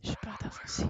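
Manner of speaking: scared whisper; whispering while hiding. Real, not acted. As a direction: whisper it, afraid. Hiding, afraid to move forward.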